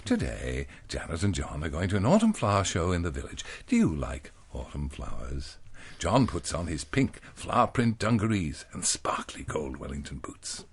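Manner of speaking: lightly hammed-up children's reader voice; reading to children. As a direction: read it like an old school primer. Reads a children's primer aloud.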